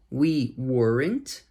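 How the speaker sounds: "We weren't" drawn out slowly; slow pronunciation demo. Shown as a demonstration. Teacher drilling students slowly. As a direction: stretch "We weren't" out carefully. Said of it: "Deixa eu ajudar com a pronúncia".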